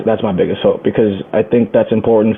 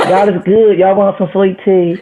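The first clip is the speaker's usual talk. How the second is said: high, slow Southern drawl, hammed up; mock Southern accent, pitched up; 9 semitones higher, slower than usual. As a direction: play a sweet-tea Southern host in a Southern accent. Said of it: "In a Southern accent?".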